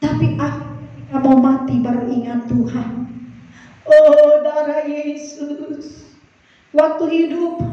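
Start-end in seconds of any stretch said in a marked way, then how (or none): 3.9-6.1 loud "oh", then drawn-out plea; mock desperate cry. Dying sinner suddenly calling on Jesus, acted desperate cry. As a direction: cry out like a hammed-up deathbed prayer.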